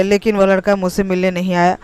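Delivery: plain reading voice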